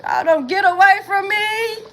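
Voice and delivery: mock shout. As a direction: yell them away.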